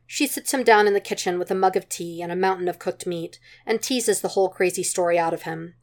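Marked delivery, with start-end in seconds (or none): none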